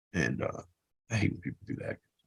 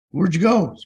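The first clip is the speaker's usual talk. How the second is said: retold loud demand; 7 semitones higher, 9 dB louder, slower than usual. Boss calls after firing.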